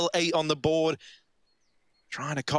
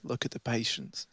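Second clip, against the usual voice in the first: softer, hushed commentary; 5 semitones lower, 8 dB quieter than usual. Real, not acted. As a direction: keep it low and tense while watching. Caster hushes, watching a clutch.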